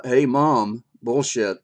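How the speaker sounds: calling out Mom. Pretend. Teller to the storied mom who faked praise, blunt challenge. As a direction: break in loudly to call her bluff. Teller confronts the mother in story.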